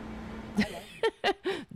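amused laugh after a slip-up. Real, not acted. Laughing at a clip played by mistake, amused.

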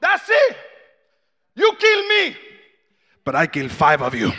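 loud tough father voice; threatening father. Retold or imitated. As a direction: bark a defiant threat at the gang.

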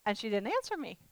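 usual speaking voice

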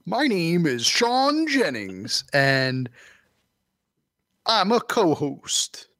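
slow, breathy announcer-style co-host; podcast co-host voice. Pretend. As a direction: deliver it slowly, like a parody host.

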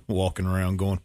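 ordinary delivery

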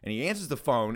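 ordinary talk

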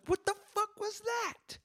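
shrill, very high falsetto mom freakout; mom losing it. Acted. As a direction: screech it like a furious mother, hammed-up. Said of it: "then she loses her shit".

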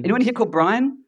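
high-pitched baffled questioning; mock puzzlement. Comedian asking audience about the name Brian, mock puzzlement. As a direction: query it like a strange foreign name.

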